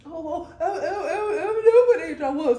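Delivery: high laughing clueless voice; clueless straight person. Acted.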